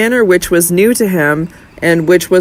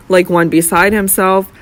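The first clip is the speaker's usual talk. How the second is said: ordinary delivery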